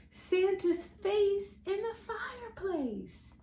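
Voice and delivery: plain reading voice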